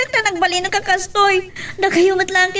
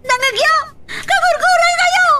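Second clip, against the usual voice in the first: high, furious yelling; angry shout; 11 semitones higher than usual. Acted. A child shouting at the parents in anger. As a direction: scream the accusation at them.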